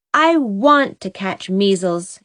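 ordinary delivery

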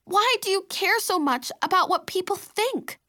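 high, insistent question; pleading, high-pitched challenge; exasperated challenge. A narrator's reading. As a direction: demand an answer in frustration. Sister challenges her in the quarrel.

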